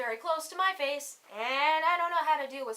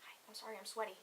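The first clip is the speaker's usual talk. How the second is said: hushed, embarrassed apology; sheepish whisper; 3 semitones lower, 13 dB quieter, faster than usual. Retold or imitated. Sweaty girl waking beside her date, sheepish whisper.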